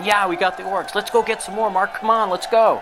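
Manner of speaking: rally the troops with a high, excited cheer. Retold or imitated. Soldiers celebrating beating the orcs, in excited high voices. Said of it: "They're excited. They're saying".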